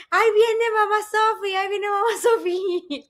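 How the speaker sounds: high-pitched mock alarm; kids' warning cry. Cousins warning each other that grandma is coming, high mock alarm. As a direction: imitate children sounding the alarm, high and urgent. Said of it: "de repente todos dicen".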